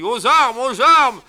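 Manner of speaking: high loud call to arms; shouting alarm. Acted. Sentry raising the alarm, shouting. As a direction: bellow the alarm. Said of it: "Tardiveau crie".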